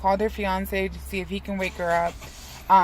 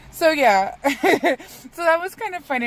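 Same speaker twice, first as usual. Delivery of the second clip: laughing; 10 semitones higher, 7 dB louder, slower than usual. Real, not acted. Laughing at the memory.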